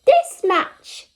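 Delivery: high squeaky little hare. Acted. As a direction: play the eager little bunny. Child hare voiced high.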